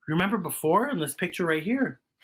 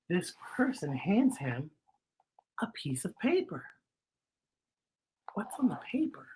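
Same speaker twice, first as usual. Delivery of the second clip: whisper; 7 dB quieter than usual. A narrator's reading. Whispered story reading.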